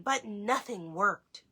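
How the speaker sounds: ordinary delivery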